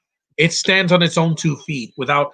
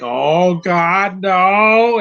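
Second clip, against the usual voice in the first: rising to a loud, high "no!"; mock panicked cry; 4 semitones higher, 5 dB louder, much slower than usual. Retelling the film scene, mock panicked shout. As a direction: ham up the villain's panic. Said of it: "He's like".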